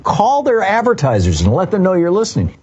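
rally listeners with a punchy, swooping pitch; upbeat station sign-off plug; radio promo read. Acted. Reads a station support promo.

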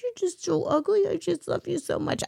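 put-on high, soft baby talk to a pet; dog dad voice. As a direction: coo at the ugly puppy lovingly. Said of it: "talk to it in Dog Dad or Cat Dad voice".

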